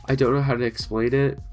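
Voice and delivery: ordinary talk, nothing marked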